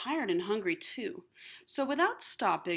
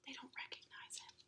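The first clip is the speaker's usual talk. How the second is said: hushed, secretive explanation; whispered aside; 4 semitones higher, 20 dB quieter, faster than usual. Real, not acted. Reader confides to the children in a whisper. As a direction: lean in and whisper it.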